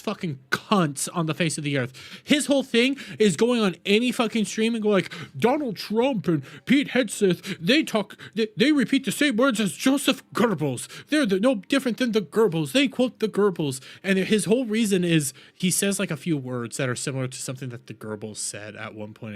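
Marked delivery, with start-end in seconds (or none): 5.4-13.8 higher-pitched, hammed-up accuser; mocking imitation. Streamer parodying a commentator on streams, mocking imitation. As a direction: parody a pundit's alarmed accusations.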